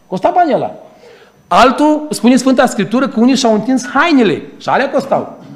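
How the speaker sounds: high, loud fervent preaching; preaching at full force. Acted. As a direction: exhort the congregation with full force. (predică înflăcărată, cu glas tare)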